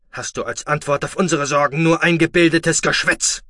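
loud, high furious rant; angry scolding. Acted. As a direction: lay into the lazy boy. Foster father berates the boy.

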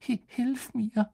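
hoch, langsam und stockend; ängstliches Stöhnen. A narrator's reading. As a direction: verzagt um Hilfe flehen. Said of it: "stöhnte er Hermine zu". (kläglich stöhnend)

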